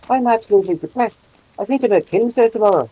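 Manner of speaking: put-on high, lilting cheer in a Republic of Ireland accent; uplifting Republic of Ireland accent. Comedian's bit on Irish accents, uplifting Republic of Ireland lilt. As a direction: chirp gloomy words brightly in a southern Irish accent. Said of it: "very uplifting".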